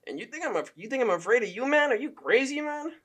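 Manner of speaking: high-pitched impression of an affronted fighter; put-on high voice, mock indignation; George Saint Pierre. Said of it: "the most you've heard George be like is".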